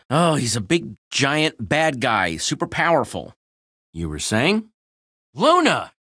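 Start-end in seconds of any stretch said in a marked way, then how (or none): 5.3-5.9 higher, louder call of the name. Acted. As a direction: call her name from afar. Calling out to the llama.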